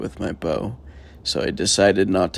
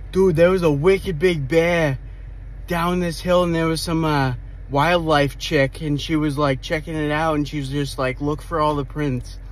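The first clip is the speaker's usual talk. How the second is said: imitating a chatty Boston construction worker, pitched up; higher-pitched mock Boston accent; thick Boston accent; 4 semitones higher, 4 dB louder than usual. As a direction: retell it as a Boston local. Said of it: "thick as Bo-, Boston accent".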